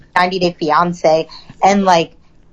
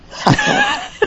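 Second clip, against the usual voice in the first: laughing. Real, not acted. Exasperated end of rant.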